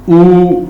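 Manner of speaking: ऊ with lips rounded forward; teacher contrasting ऊ with ई; vowel oo demo. Shown as a demonstration. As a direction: push the lips out for ऊ. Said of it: "अब ऊ के".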